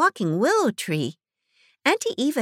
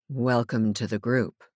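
much lower, grave welcome; imposing man; 10 semitones lower, 3 dB quieter than usual. A narrator's reading. Imposing man in fringed jacket welcomes them, low and grave. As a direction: greet them with weighty dignity. Imposing native greets newcomers.

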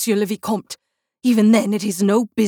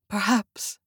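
soft, quiet, hopeless perhaps; love and despair; 4 dB quieter than usual. A narrator's reading. Raoul answers Christine in soft loving despair. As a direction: let the word fall in heartbroken surrender. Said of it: "with so much love and despair in his voice".

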